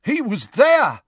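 high excited shout. Acted. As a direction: call it out across the hall. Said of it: "shouted a scarlet-robed man".

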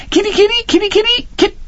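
very high sing-song kitty call; boy calling the tiger like a pet cat. Acted.

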